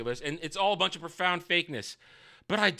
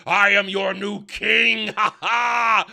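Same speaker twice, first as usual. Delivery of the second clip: loud, slow gloating with a laugh; mock villain king; 4 semitones higher, 7 dB louder, slower than usual. Pharazôn claiming the throne, hammed-up gloating laugh. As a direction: gloat over the crowd like a villain. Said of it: "Farazan's like".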